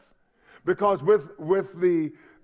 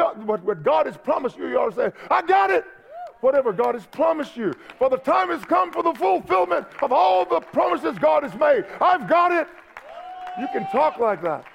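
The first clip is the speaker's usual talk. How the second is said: fiery preaching; 6 semitones higher than usual. Acted. Pastor declares to his congregation that God's promise is done, in a fiery preaching shout. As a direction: preach it like it has already happened.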